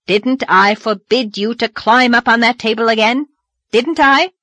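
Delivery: loud, rising, high demand; angry scolding. Acted. Marilla shaking Davy, loud angry scolding. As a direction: pin him down with each question.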